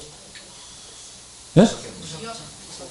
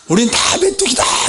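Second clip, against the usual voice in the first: mock wailing; 6 semitones higher, slower than usual. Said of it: "전부 통곡하고 울고".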